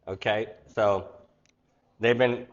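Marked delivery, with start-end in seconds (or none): none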